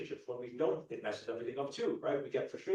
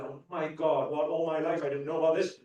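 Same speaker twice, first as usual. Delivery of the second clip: loud, raised, amazed exclamation; thrilled discovery; 4 semitones higher, 10 dB louder than usual. Retold or imitated. Acting someone first finding law of attraction, thrilled discovery. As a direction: burst out as if a secret box just opened.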